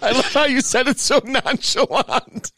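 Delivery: high-pitched, laughing through the words; real cracking up, voice pushed up high by laughter; laughing in disbelief. Real, not acted. Repeating a friend's absurd phrase back to him, cracking up.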